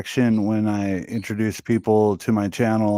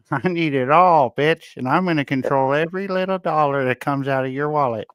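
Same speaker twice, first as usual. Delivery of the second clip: hammed-up high whiny voice; mock streamer; 5 semitones higher than usual. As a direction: parody the streamer demanding his money. Mock streamer claiming the money.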